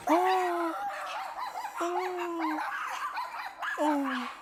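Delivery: excited cooing. Real, not acted. Cooing over dogs in bandanas.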